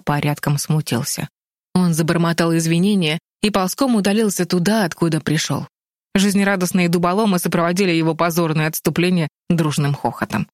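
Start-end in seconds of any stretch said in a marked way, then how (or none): none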